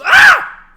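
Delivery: piercing high, very loud shriek; scream. Retold or imitated. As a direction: imitate a terrified kid's scream. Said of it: "why'd you scream last night".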